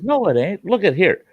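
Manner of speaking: put-on high, insistent old-man voice; a touch of a cranky old man; imitating old brother. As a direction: squeak it out like a stubborn old farmer. Brother quoted in movie retell.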